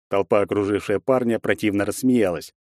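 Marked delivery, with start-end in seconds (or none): none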